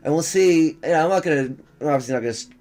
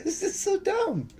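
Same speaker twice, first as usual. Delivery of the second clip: laughing disbelief; 8 dB quieter, slower than usual. Real, not acted.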